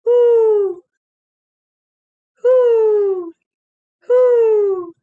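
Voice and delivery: loud high hooting; loud high owl hoots. Shown as a demonstration. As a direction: call hoo into the night like an owl. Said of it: "It sounds like".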